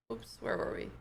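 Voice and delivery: breathy whispered aside; whispering. Real, not acted. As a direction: whisper it to yourself. Unvoiced aside.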